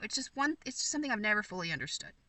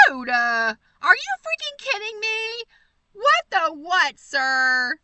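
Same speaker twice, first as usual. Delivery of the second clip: much higher and louder, blurting out disbelief; stunned excited shout; 7 semitones higher, 10 dB louder, slower than usual. Real, not acted. Viewer sends a gift, she shouts in stunned delight. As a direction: yell it, floored by the surprise.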